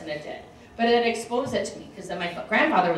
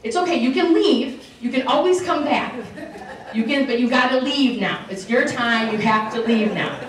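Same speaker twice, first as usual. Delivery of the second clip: parents reassuring; 3 semitones higher, 4 dB louder than usual. Retold or imitated. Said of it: "like what my parents said to me".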